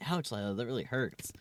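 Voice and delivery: ordinary talk, nothing marked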